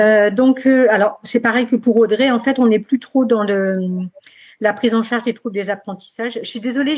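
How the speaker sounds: slight lisp from a dental retainer; talking with a retainer in, lisping. Real, not acted. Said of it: "donc je zozote un peu".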